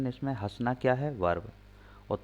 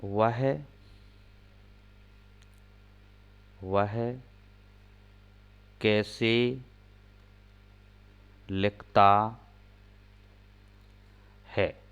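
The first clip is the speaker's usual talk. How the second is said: slow, with long gaps between words; slow dictation pace; dictating word by word; much slower than usual. Shown as a demonstration. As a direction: dictate each word for writing. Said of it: "एक और sentence देखते हैं".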